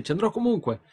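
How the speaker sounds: high-pitched mock yell, no real volume; retold defiant cry. Daughter defying her parents, retold high-pitched cry. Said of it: "Lei gridò e disse".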